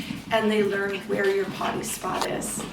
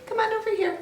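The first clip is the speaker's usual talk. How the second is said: much higher coaxing call; high sing-song call to a puppy; 12 semitones higher, much faster than usual. Real, not acted. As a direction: coax the puppy to come over. Calling puppy over.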